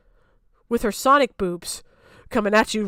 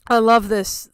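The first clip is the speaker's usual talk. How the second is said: normal talking voice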